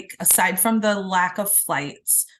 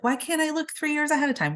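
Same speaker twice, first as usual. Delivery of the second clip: higher-pitched, quick quoted question; mock impatient wondering; 8 semitones higher, faster than usual. Traveler searching online wonders aloud, mock impatient. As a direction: voice your own puzzled question, high and fast.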